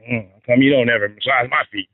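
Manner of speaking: lightly voiced sloppy whine about feet; sloppy wife voice. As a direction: sound like a lazy nagging wife. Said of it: "See how I did the voice?".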